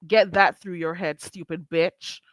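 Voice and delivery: usual speaking voice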